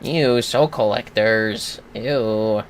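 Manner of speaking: slow, drawn-out ew; grossed out by the enemies, drawn slowly; a lazy, slow eww of distaste; mock disgust. Real, not acted.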